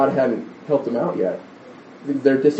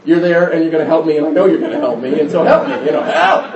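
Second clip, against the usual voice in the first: put-on demanding child; 4 dB louder than usual. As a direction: demand help, laughing through it by the end. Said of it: "she was demanding my help".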